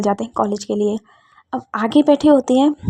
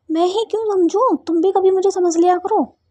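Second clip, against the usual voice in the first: much higher, whiny protest; pitched-up complaint; 8 semitones higher than usual. Acted. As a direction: whine it up high at her friend. Kittu complains in the car.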